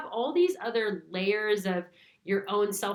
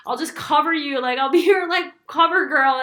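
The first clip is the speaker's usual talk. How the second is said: louder, higher, laughing offer; playful mock offer; 7 semitones higher, 11 dB louder than usual. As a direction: ham up the helpful friend.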